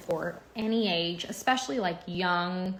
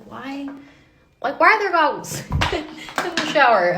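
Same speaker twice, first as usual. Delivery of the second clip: exasperated; 8 semitones higher, 10 dB louder than usual. Real, not acted. Exasperated at clutter in shower.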